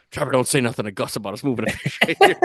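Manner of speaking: half-laughing conspiratorial order; laughing aside. Retold or imitated. Father telling son to keep the fish secret, laughing.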